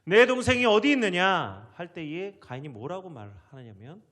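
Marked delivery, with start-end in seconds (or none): 0.0-1.7 loud, higher-pitched demand; preacher lightly voicing God's interrogation; God questioning Cain. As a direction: put the question forcefully, as God. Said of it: "가인에게 하나님 물어보십니다".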